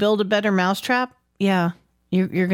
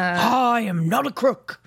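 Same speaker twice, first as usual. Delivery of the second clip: slow, hammed-up Nixon voice; quoting Nixon, slow and put-on; Nixon quote; 2 semitones higher, slower than usual. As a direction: drawl it out like Nixon denying. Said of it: "that's a reference".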